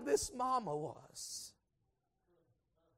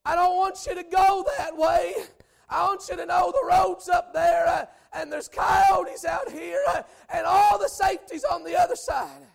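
loud pleading call, higher; 5 semitones higher, 7 dB louder than usual. Retold or imitated. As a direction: call after the lamb across the field. Calling after runaway lamb.

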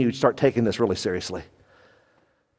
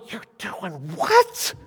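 slow, drawn-out astonished question; 3 semitones higher, 3 dB quieter, slower than usual. Retold or imitated. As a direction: stretch it out in disbelief. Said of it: "I didn't say this out loud, but I'm going".

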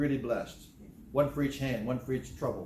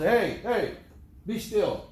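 put-on loud sharp call to a child; father calling out to his son to be still; father scolding; 6 semitones higher, 4 dB louder, much slower than usual. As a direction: snap at a restless boy.

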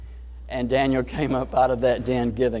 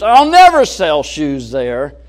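mock discouraged salesman; 5 semitones higher, 10 dB louder than usual. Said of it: "a negative attitude that was somewhat depressing".

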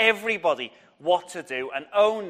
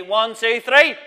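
shouted count; 4 semitones higher, 8 dB louder, slower than usual. Shown as a demonstration. Teacher leading the children's shout, loud counting. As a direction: count rising, higher and louder. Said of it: "so you ready?".

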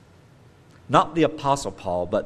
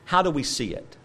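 ordinary delivery

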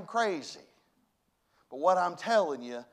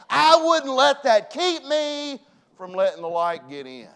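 loud, high, rousing pulpit cry; rally the congregation at full volume; full-force preaching; 9 semitones higher, 12 dB louder than usual. Real, not acted. Preacher cheering the unsaved on to come forward, full force.

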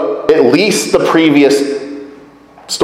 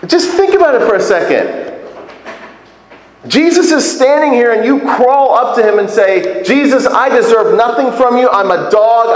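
incredulous urging; 8 semitones higher than usual. Acted. Preacher urging listeners to imagine.

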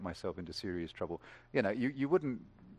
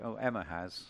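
ordinary delivery

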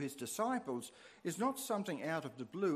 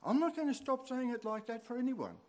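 higher-pitched mock-stubborn retort to laughing children; retold stubborn refusal in a raised pitch; defiant retort; 9 semitones higher than usual. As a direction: dig your heels in, pitched up. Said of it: "and I say to them".

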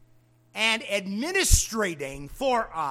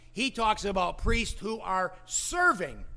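usual speaking voice

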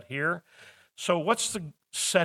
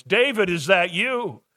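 raised, higher calling question; louder, higher acted call; 6 semitones higher, 6 dB louder than usual. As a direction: call out to someone unseen in the dark. Saul calls out to David at night.

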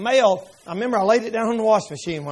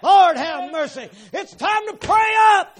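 full-force preaching; 5 semitones higher than usual. Acted. Preacher exhorts congregation, at full force. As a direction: preach it to the rafters.

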